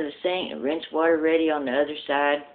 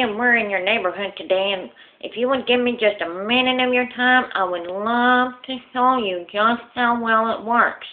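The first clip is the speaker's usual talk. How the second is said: lisping salesman; 6 semitones higher than usual. Acted. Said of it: "he talks with a lisp".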